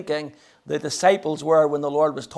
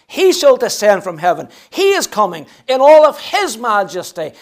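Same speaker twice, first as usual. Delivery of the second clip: higher, emphatic preaching with punched key words; impassioned preaching; 7 semitones higher, 8 dB louder than usual. Real, not acted. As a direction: preach it with rising force, driving each repeated phrase home. Preaches at full force.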